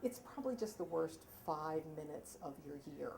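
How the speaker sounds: soft, low motherly understatement; sweet, reluctant mother. Retold or imitated. Sweet mother warns her nineteen-year-old about the exam, softly and reluctantly. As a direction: break it gently, voice dropped right down. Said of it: "the most that she could bring herself to say, that sweet, wonderful person".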